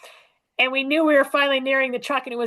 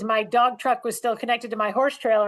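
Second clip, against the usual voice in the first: usual speaking voice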